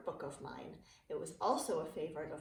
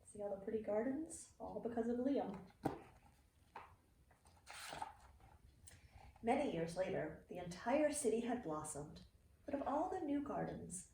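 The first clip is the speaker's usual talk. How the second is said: put-on reading to children. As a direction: read it like a bedtime picture book for kids. Reading a picture book aloud.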